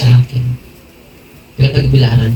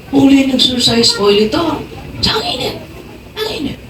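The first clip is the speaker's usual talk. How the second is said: burning man; 12 semitones higher than usual. Retold or imitated. Man telling his wife the holy oil burns him. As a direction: whimper that it burns. Retold dialogue, husband in pain.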